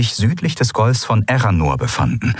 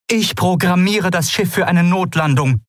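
Aylan explaining; 10 semitones higher than usual. A narrator's reading. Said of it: "sagte Aylan".

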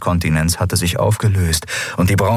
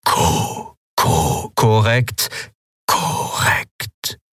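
higher, slow, stuttering machine voice that keeps glitching; failing machine voice; 3 semitones higher, much slower than usual. Acted. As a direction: stammer like a broken machine. (stotternde, defekte Maschinenstimme)

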